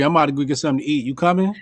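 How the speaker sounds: fast, offhand invite; casual quick invite. Shown as a demonstration. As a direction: toss it off like it's nothing. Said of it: "That's how I'm saying it".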